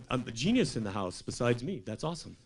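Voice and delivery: ordinary talk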